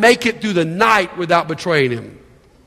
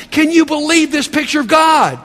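exclaimed; 6 semitones higher than usual. Real, not acted. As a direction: call it out in amazement. Preacher exclaims to congregation.

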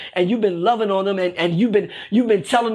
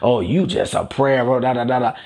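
mock admirer; 8 semitones lower than usual. Imagined churchgoer praising someone's prayer life, mock-admiring in a low voice. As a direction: play a fake fan, pitched well down. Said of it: "No one's gonna be commenting on you and saying".

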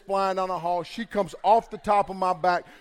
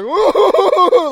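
high, loud laughing ooh; laughing squeal; 14 semitones higher, 15 dB louder than usual. Retold or imitated. Nicole laughing hard on the floor, a high laughing squeal. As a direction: copy her laugh. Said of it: "like this".

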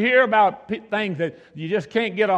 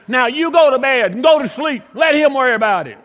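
higher, louder, firm order; bossy wife; 6 semitones higher, 7 dB louder than usual. Retold or imitated. Wife ordering her husband to bed, loud and satisfied. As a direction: settle it like a boss.

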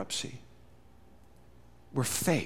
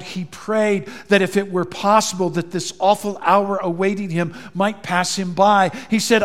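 ordinary delivery

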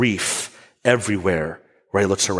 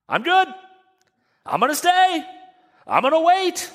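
high, breezy and unbothered, much higher; casual Jesus voice. Retold or imitated. Preacher voicing Jesus as unhurried and relaxed. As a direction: play him shrugging it off.

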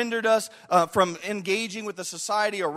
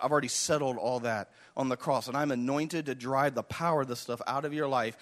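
much lower, softer sermon voice; hushed, earnest promise of forgiveness; tender preaching; 8 semitones lower, 4 dB quieter than usual. Acted. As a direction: drop low and quiet to reassure the congregation.

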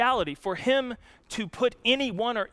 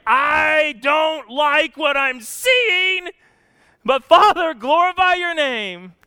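impassioned cry; 4 semitones higher, 5 dB louder, slower than usual. Acted. Preacher voicing Jesus' prayer.

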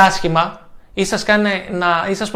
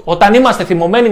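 loud demo; 3 semitones higher, 9 dB louder than usual. Shown as a demonstration. Coach showing how angry people sound, loud. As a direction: say it with an angry push. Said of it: "μιλάμε δυνατά".

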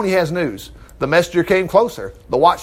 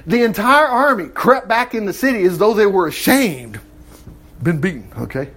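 ordinary talk, nothing marked

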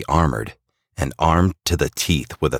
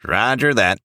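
higher-pitched pilot acknowledgement; Rudolph; 9 semitones higher than usual. A narrator's reading. Rudolph acknowledges Santa's banking order, higher pilot voice. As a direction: confirm like a reindeer pilot. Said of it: "answers Rudolph".

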